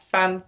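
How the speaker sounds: "Fan" flicked out lightly. Shown as a demonstration. Teacher demonstrating with a fist flick, "Fan" light. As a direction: toss off "Fan" like opening a fist. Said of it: "like you're flicking it out".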